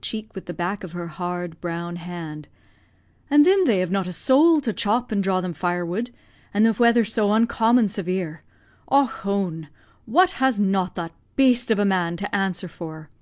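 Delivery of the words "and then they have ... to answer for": put-on lamenting old Irish nursemaid, raised and heavy; old Irish servant. Old servant grieving for the deserted family, Irish voice. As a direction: grieve aloud for the starving family, in a broad Irish lilt.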